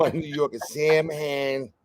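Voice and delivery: put-on jokey New York accent; mock New Yorker. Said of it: "give you my New Yorker".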